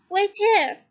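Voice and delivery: loud call to a friend. A narrator's reading. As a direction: call it across the distance. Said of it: "he called to Ron".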